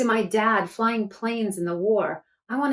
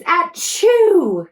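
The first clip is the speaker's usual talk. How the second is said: much higher, louder mock sneeze; 9 semitones higher, 9 dB louder than usual. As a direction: fake a big sneeze. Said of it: "Akiko sneezes".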